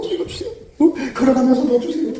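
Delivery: high, strained pleading prayer; groaning plea. Acted. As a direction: beg urgently, as if groaning under your breath. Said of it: "이 신음소리가 막".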